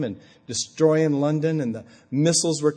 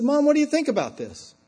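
calling to mom; 13 semitones higher than usual. Retold or imitated. Talking to his elderly, distracted mother.

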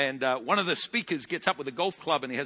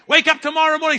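ordinary talk

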